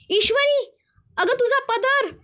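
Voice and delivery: high-pitched urgent cry of warning; alarmed shout. A narrator's reading. Anjali warns Ishwari her sari is on fire, alarmed cry. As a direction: call out sharply in alarm, pitched well up. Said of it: "जोरात ओरडते".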